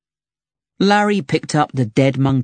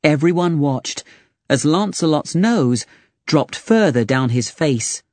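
plain reading voice

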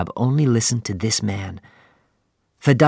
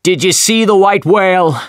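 high, loud shout over the wind; a captain hailing another ship across the waves; shouting across the sea; 9 semitones higher, 6 dB louder than usual. Acted. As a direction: call out to a far ship. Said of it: "Captain Ahab shouted from the Pequod".

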